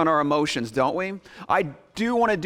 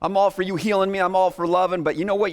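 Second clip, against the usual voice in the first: usual speaking voice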